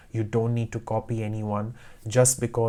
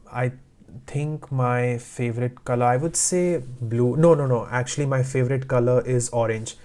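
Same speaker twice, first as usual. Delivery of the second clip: hesitant, dithering answer. Shown as a demonstration. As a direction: think aloud haltingly, then abruptly change your mind. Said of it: "Don't take so long".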